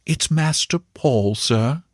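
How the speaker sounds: slow, lightly reverent confidence; secret, religious voice. A narrator's reading. Servant confiding the boy's gift to the uncle, reverent. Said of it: "in a secret religious voice".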